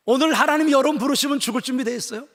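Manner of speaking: booming sermon question, rising at the end; loud preaching. Acted. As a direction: call it out to the whole room. Sermon question asked twice.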